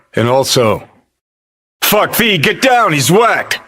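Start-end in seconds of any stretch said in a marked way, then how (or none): 1.8-3.6 high-pitched warning cry; mock urgent shout. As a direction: yell it like urgent alarm, much higher. Acts out a panicked warning.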